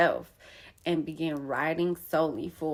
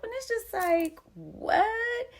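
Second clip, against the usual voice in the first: incredulous; slower than usual. Real, not acted. Baffled at sister's accusation.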